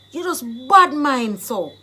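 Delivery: emphatic, indignant call-out; accusing, jealousy charge. Shown as a demonstration. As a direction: hit "bad mind" hard. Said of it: "you are jealous of me".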